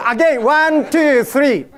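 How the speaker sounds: loud cue for a group vote; counting off. Real, not acted. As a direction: call out the count to a crowd.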